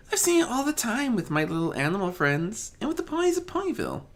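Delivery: a shy pony sharing what she has seen; gentle timid pony. Acted. Fluttershy speaking.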